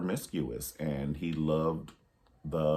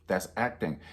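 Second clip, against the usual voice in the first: usual speaking voice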